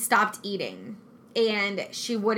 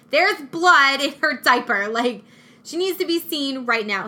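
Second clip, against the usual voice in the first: urgent demand; 4 semitones higher, 6 dB louder than usual. Retold or imitated. Demanding at hospital desk.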